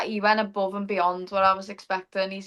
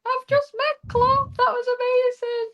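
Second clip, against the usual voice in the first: overwhelmed excitement; 3 dB louder than usual. Retold or imitated. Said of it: "rang me dad and was like".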